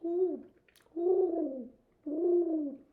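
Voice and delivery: high, steady owl hoots. Shown as a demonstration. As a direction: hoot slowly, one call at a time. Hoots again for the child.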